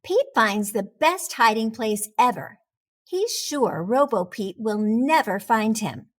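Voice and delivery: plain reading voice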